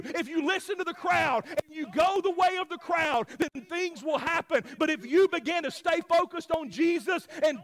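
loud, high, driving sermon delivery; full-force preaching. Real, not acted. Pastor urging the church to stay focused on Jesus, impassioned preaching. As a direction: preach it hard, punching each phrase.

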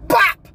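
bop. Acted. Sudden setback sound effect.

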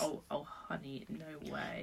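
soft condescending dismissal. Real, not acted. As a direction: pat her on the head, softly. Mocking friend's claim.

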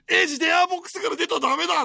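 high, loud plea to the screen; pleading shout. Retold or imitated. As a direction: beg the hero not to leave. Drunk friends pleading with TV. (必死な叫び)

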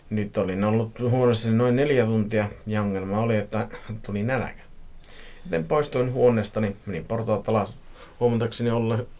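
plain reading voice

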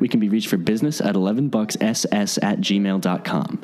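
steady announcer read of the sign-off; podcast outro. Acted. Host closing the episode for listeners, announcer read.

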